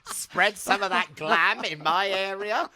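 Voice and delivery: high, laughing demand; comic indignation with a laugh; retold mock complaint. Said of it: "And I'm like".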